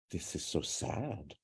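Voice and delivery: mock sad.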